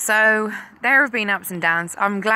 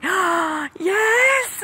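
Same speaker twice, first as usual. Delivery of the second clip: put-on high, loud childish cheer; high excited kids' shout; excited children; 14 semitones higher, 4 dB louder than usual. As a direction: squeal it like thrilled kids. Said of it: "they were so excited about it".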